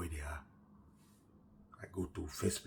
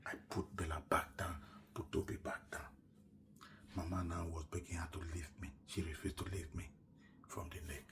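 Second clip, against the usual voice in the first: hushed; 3 semitones lower, 11 dB quieter than usual. Retold or imitated. Because the children were sleeping.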